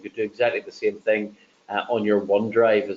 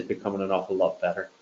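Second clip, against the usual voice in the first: ordinary delivery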